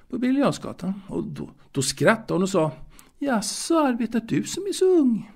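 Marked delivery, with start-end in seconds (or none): 3.2-5.3 much higher, lightly voiced middle-aged lady; lady's amused question. Boy recalls the lady on the bench asking, in a high lady's voice. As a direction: put on the lady's voice, raised high.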